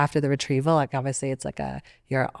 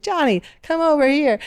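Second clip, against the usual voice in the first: much higher, louder mock call across the alley; calling a friend over; 12 semitones higher, 6 dB louder than usual. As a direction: call Johnny over, half shouted. Stranger calls his friend over.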